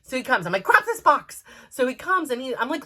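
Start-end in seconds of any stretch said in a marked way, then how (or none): 0.6-1.4 high, loud command; urgent order. Retold or imitated. Mom to son in duck rescue, loud urgent order. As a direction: shout it like there is no time.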